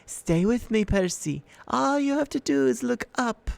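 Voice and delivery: high, honeyed temptation; luring monster voice. A narrator's reading. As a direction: coax him to look up. Medusa lures Percy.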